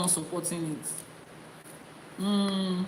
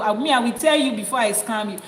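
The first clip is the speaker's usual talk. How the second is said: ordinary delivery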